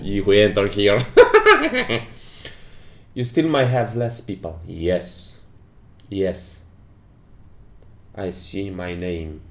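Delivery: ordinary talk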